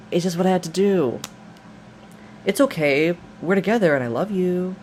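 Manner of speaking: a lightly voiced guilty lover talking her round; mock sweet apology to his girlfriend; pleading boyfriend. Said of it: "and he's like".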